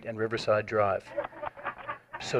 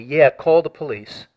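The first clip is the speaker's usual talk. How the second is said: slow, louder mocking echo; 3 semitones higher, 5 dB louder, slower than usual. Caller imitating the attacker taunting passersby, slow mocking echo. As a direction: throw the words back with a sneer, slowly. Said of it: "The guy yelled to them mockingly".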